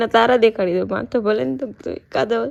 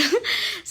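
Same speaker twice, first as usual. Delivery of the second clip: giggle; 10 semitones higher, 6 dB quieter than usual. Real, not acted. Teasing viewers, giggles.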